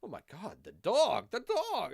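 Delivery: imitated public panic, high and loud; mock outcry. Imitating everybody upset over the dognapping, mock outcry. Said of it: "and everybody was all".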